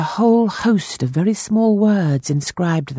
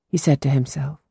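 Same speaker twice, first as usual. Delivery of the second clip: plain reading voice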